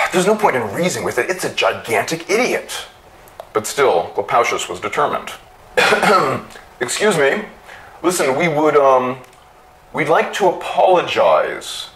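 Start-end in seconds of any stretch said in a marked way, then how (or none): 0.1-2.9 complaining. A narrator's reading. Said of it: "Twirl complained". 5.8-6.4 a small nervous ahem; polite throat clear. A narrator's reading. Clopacius politely clears his throat at the machine. 6.8-11.9 high, careful apology; timid pleading. A narrator's reading. Clopacius nervously apologizing to the trapping machine, high and timid.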